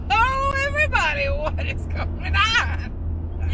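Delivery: high, slow, drawn-out hello; excited greeting. Real, not acted. Vlogger greeting viewers from the car, high and drawn out. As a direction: greet the viewers like a big reveal.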